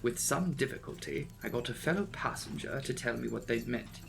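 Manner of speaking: ordinary delivery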